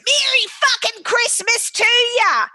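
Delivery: high, loud, cheeky shouted greeting; retold festive yell. Auntie answering the phone to her brother, loud cheeky shout. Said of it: "she picked up the phone and goes".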